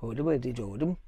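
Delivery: sing-song gibberish in a Scandinavian lilt; mock Scandinavian accent. Vlogger showing off the accent, mock Scandinavian gibberish. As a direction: babble like a pretend Scandinavian. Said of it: "That's my, um, Scandinavian accent".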